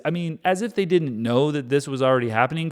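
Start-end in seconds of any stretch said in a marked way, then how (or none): none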